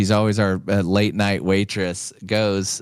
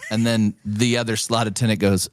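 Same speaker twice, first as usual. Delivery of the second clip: normal talking voice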